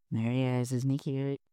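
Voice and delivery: ordinary talk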